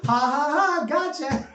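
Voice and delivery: playful gotcha jeer; mock taunt. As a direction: tease them with a drawn-out fake laugh. Taunting sign read out.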